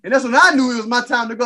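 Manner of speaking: usual speaking voice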